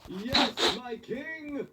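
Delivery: high, slow drawn-out praise; slow hammed-up adoration; mock worship. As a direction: hail him like royalty.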